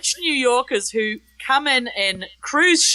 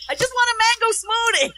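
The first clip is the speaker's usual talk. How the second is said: mock yell, pitched up; calling out a request across the street; retold yell; 11 semitones higher than usual. Husband calling to his wife outside the shop, pitched up. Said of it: "proceeds to yell to her".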